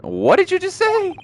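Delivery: shocked question with a sharply rising end; acted disbelief. Game hero shocked by accusation.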